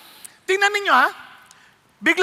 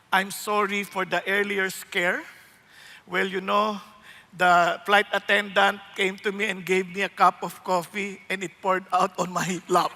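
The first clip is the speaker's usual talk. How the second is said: low, slow captain's announcement; pilot on intercom; 11 semitones lower, 6 dB quieter, slower than usual. Retold or imitated. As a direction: speak like a calm pilot over the intercom. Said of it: "sabi nung kapitan". (mababa at mabagal na boses ng piloto)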